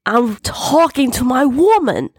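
high, angry holler; thundering. A narrator's reading. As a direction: bellow it like a furious husband. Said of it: "he thundered at whoever was out there".

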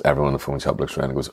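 usual speaking voice

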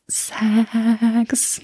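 slow whispered aside; whispering. Real, not acted. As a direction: whisper it like a secret. Conspiratorial aside.

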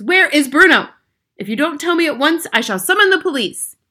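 loud, high, threatening demand; angry cry. A narrator's reading. Father threatening police to get his son back, loud and high. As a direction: threaten her at a shout. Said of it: "cried Mister Jenkins".

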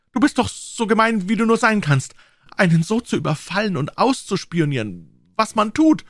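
put-on high, tearful girl's voice scolding; upset girl. A narrator's reading. Becky scolds Tom for catching her, high tearful voice. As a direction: scold him through shame and tears. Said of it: "brach in bitteres Schluchzen aus".

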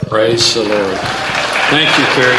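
big happy shout to the congregation; loud joyful praise. Real, not acted. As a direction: lift the room with your thanks. Pastor cheers after testimony.

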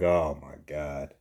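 low, soft, drawn-out groan of dread; weary dread. Retold or imitated. Boss who already knew why he called, weary dread. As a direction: sigh it out slowly, resigned. Dreading another sick call from employee.

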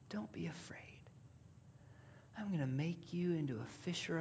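hushed, tender near-whisper; Jesus gently. Acted. Jesus reassuring Peter on the boat, hushed and tender. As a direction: soothe a frightened friend softly.

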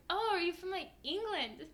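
imitating a curious stranger, high-pitched; intrigued question. Coffee shop staff asking about her accent, intrigued. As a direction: act out wide-eyed curiosity.

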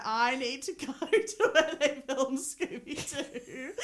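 slow, high, giggly mock insistence; laughing self-quote. Co-host retells pleading with partner over a holiday, laughing. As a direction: draw it out through laughter.

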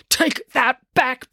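slow, high-pitched, drawn-out bellow; angry bellow. A narrator's reading. Finn bellows at Kiran for insulting mum, angry. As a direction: bellow it slowly, each word leaned on. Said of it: "bellowed Finn".

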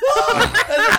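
high, loud laughing mock scream; terrified scream. Brother shrieking as a stranger attacks. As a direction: squeal in fright.

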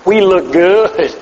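slow, stretched-out boast; drawn-out self-approval. Acted. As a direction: draw the words out, pleased with yourself. Mocking self-satisfied outward appearance.

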